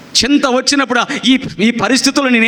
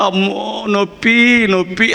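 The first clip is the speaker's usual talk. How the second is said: mock pain moan; slower than usual. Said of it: "అదే చింత".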